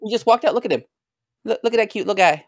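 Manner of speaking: high-pitched cooing over a tiny creature; cooing delight. Real, not acted. Showing friends the little moth, high cooing delight. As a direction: gush over it in a high, doting voice.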